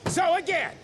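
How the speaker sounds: furious rant. Acted. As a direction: tear into them all. Furious secretary berating the cell.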